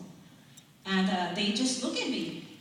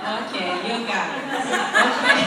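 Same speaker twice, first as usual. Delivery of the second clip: fast, louder words through laughter; laughing; 11 dB louder, faster than usual. Real, not acted. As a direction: laugh out the okay. Laughing after the punchline.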